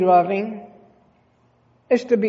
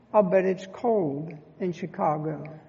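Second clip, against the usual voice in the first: softer, hushed and mournful; sad voice; 3 semitones lower, 7 dB quieter than usual. Retold or imitated. Preacher voicing the grieving widow, quietly sad. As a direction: let the grief sit under the words. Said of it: "I heard a sad voice".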